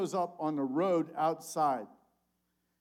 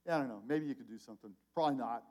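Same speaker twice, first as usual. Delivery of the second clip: doubtful throwaway; 5 semitones lower, 5 dB quieter, faster than usual. Retold or imitated. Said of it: "It's sort of a throwaway".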